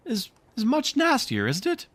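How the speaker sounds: put-on high, sweet little-girl voice; encouraging little girl. Wendy encourages her father, high and sweet. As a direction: coax Father gently, pitched high like a child. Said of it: "to encourage Michael".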